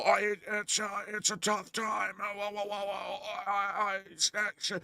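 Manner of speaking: high, soft, crying-like voice; shaky whimpering impression. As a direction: whimper it out like a frail old man. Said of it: "He literally sounds like".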